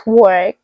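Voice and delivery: "work" said slowly with a sounded r, "wurk"; careful r-coloured "work"; adapted pronunciation. Retold or imitated. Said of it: "learn how to say some things".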